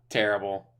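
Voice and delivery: normal talking voice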